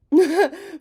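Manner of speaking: loud, high laugh; burst of laughter. Real, not acted. Laughing at blowing her nose wrong, loud burst.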